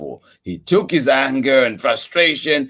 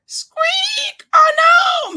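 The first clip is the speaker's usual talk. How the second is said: high, loud squeaky yell; 11 semitones higher, 5 dB louder, much slower than usual. Acted. Mister Mouse yells as the tusk breaks. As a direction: shriek it like a panicked mouse. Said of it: "Mister Mouse yelled".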